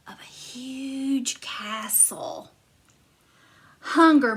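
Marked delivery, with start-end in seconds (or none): 0.1-2.5 hushed, slow whisper; spooky storybook whisper. A narrator's reading. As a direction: breathe it like a secret. Narrator whispers entering castle.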